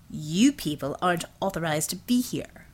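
low, cold officer voice; venomous. Acted. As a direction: look down on them and bite it off. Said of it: "He spat out the words like venom".

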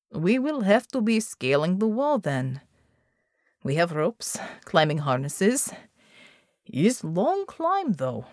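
put-on accented team leader thinking it over; foreign-accented team leader. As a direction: play a team leader weighing a climb. Narrator voices Molotok, team leader.

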